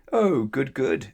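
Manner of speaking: pleased exclamation, high loud start dropping away; exclaiming. A narrator's reading. Twins get their letters at breakfast, exclaiming. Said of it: "exclaimed the twins".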